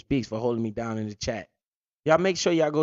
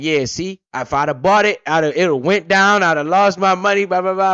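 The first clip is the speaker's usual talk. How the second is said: smug; 4 semitones higher, 8 dB louder than usual. Retold or imitated. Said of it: "I was like".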